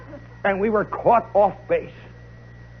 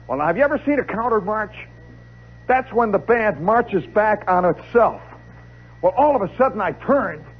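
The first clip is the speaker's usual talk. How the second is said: normal talking voice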